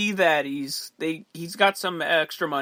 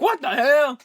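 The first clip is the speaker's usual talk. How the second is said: loud, high-pitched film-quote outburst; raised, put-on outrage; mock outraged; 9 semitones higher, 5 dB louder than usual. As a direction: act out an indignant yelp. Acting out angry reaction to salt hit.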